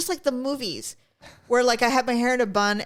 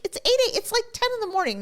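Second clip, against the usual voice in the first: 1 incredulous scoff; 13 semitones higher than usual. Retold or imitated. Brushing off worried husband.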